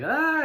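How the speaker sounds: high, loud exasperated "ah"; overwhelmed yelp. Retold or imitated. Someone swamped by a sudden crisis, loud high yelp. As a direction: yelp like it all landed at once. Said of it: "You're just like".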